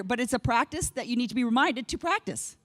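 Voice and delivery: ordinary delivery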